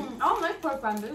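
talking between bites; chewing while talking. Real, not acted. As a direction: mouth partly full. Seen 1: likely speaker on right brings food to mouth, chewing; speaker unsure.

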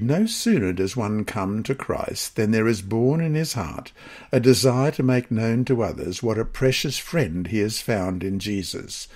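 ordinary talk

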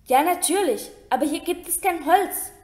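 high, frantic outcry; crying out. Acted. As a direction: wail it, wringing hands. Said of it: "schrie Hermine händeringend".

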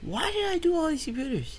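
exasperated. Real, not acted. Exasperated complaint while playing.

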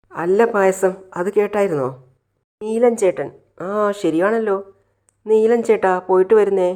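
high, loud mock farewell call; teasing mimicry. Friend mimics a girl's goodbye to Neelan, teasing.

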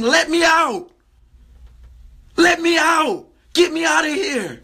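mock screaming. Blessing inside a believer begging for release, mildly acted scream. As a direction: plead like something trapped inside, only half screaming.

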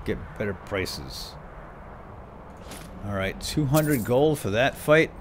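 ordinary delivery

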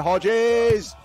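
loud high shout on Hodges; excited shout. Real, not acted. Commentator calling the winning spike, shouting. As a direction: bellow the point. Point for Australia.

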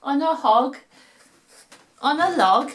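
put-on lively sing-song reading to children; storytelling voice. A narrator's reading. Narrator reading a rhyme to kids at home, playful storytelling voice. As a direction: read it like a bedtime rhyme for little ones.

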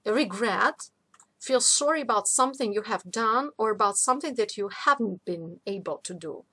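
normal talking voice

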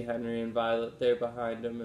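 ordinary delivery

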